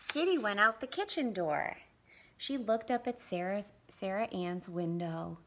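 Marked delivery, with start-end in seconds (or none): none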